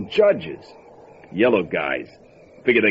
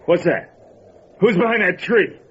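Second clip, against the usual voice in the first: sudden high alarmed questions, louder; he spins toward a sound in the dark; startled alarm; 7 semitones higher, 3 dB louder than usual. Acted. Gunman hears something behind him.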